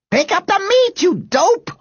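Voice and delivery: very high exasperated yell. A narrator's reading. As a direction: yell at a dawdling friend. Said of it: "yelled Robert".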